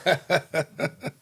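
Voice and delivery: high chuckling laugh. Real, not acted. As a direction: burst of amused giggles. Amused at own habit.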